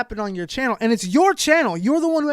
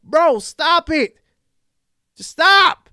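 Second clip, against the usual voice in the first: fed-up stop; 8 semitones higher, 6 dB louder, slower than usual. Real, not acted. Scolding the YouTuber.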